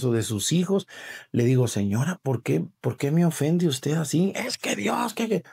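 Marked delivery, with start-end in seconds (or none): 1.7-4.3 señora alterada, voz más aguda; discusión recreada. Retold or imitated. Sacerdote recrea discusión con señora. 4.3-5.4 voz mucho más aguda, balbuceando; imitando a la señora. Retold or imitated.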